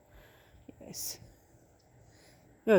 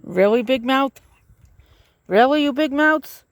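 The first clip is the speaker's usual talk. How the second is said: louder, higher teasing call to the birds; playful loud tease; 5 semitones higher, 7 dB louder than usual. Real, not acted. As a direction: call out to the noisy birds, mock-scolding. Teasing noisy birds at the feeder.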